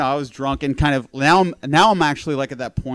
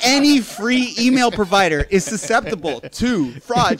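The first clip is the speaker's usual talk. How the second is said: laughing; 8 semitones higher, 5 dB louder than usual. Real, not acted. Lecturing while laughing.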